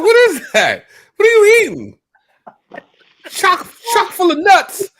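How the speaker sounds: high, loud, startled exclamation; surprised. Retold or imitated. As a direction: react with loud shock. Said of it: "I was like".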